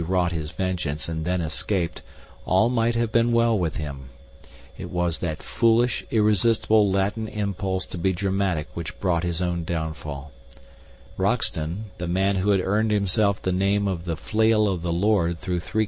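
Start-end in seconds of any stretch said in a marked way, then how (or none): none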